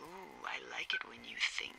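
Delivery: soft, low, teasing; flirty half-whisper. Acted. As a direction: tease him in a near whisper. Tipsy flirting over the radio.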